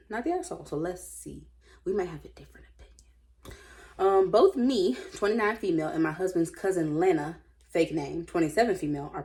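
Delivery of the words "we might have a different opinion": whispered aside; whispering a sly aside to viewers. Real, not acted. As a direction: lean in and whisper it. Conspiratorial aside.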